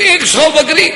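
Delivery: high, disbelieving rise on ایک سو بکری; can it really be a hundred goats; astonished question. Acted. The bedouin asking the Prophet in disbelief, astonished.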